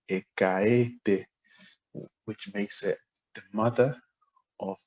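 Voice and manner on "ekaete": ekaete said slowly, syllable by syllable; slow tonal demo; tonal compound ekaete. Shown as a demonstration. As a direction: draw out ekaete with its tones. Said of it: "we say".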